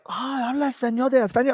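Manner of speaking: high-pitched mock Castilian Spanish; Spaniard impression. Spanish pilgrims mistaking priest for a Spaniard, high mock Castilian. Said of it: "they thought I was like".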